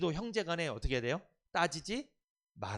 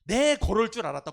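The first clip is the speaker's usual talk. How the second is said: loud, high mock I-knew-it; mock triumphant spouse gloating; nagging spouse; 7 semitones higher, 12 dB louder than usual. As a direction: snap it like a smug spouse catching you. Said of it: "꼭 이래".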